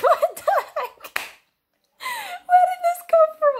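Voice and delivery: high, loud, laughing outcry; laughing disbelief. Retold or imitated. Reacting to the stolen bottle, high laughing outcry. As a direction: squeal it out through laughter. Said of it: "And I'm like".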